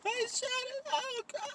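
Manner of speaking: put-on very high, strained little-girl voice; high squeaky voice. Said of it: "I was all".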